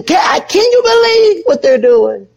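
high, scornful "Can, can you believe what they're doing?" with a rising tone; judgmental gossip. Retold or imitated. Preacher mocking his old judgmental self, high scornful gossip. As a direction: sneer at them in a high, shocked voice. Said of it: "real critical and judgmental and just say".

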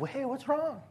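lightly voiced fellow pastor. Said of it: "he's like".

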